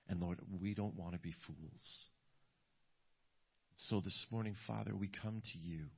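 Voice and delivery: hushed closing prayer to the Lord; leading prayer. Real, not acted. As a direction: pray aloud softly, with pauses between phrases. Leads the congregation in prayer.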